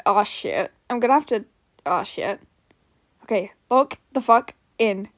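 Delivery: ordinary talk, nothing marked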